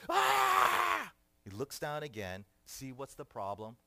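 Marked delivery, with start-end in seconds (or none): 0.1-1.1 long, high, loud scream; toddler screech. Acted. Son in the checkout line, screeching. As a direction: let out a piercing toddler shriek. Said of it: "he hears his son".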